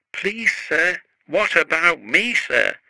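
high, eager worried plea; anxious. A narrator's reading. Peter Sam asks the controller for a job, high and anxious. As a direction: ask high and nervous, hoping. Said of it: "asked Peter Sam anxiously".

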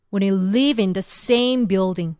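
very slow, "live" pressed; stress on live. Shown as a demonstration. Teacher marking the key words, slow stress on "live". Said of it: "이렇게 누르시면 된다는 거".

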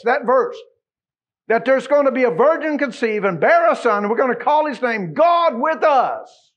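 loud, high, ringing proclamation; fervent revival preaching; preaching at full force. Acted. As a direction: build the prophecy into a shout.

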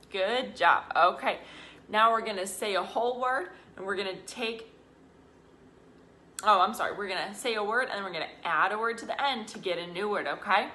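usual speaking voice

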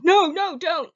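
high, loud pleading cry; desperate shout. Retold or imitated. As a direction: yell to stop her in time. Menfolk trying to stop her.

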